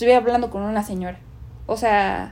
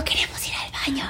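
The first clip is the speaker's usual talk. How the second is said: whisper; 10 dB quieter than usual. Retold or imitated. Night, scared child asking mom's boyfriend.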